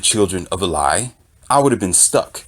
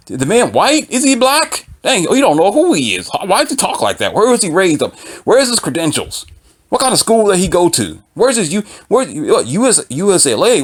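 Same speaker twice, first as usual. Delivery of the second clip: much higher, incredulous mock questions; nosy commenter; 8 semitones higher than usual. Imitating commenters quizzing the tutor's background, high and nosy.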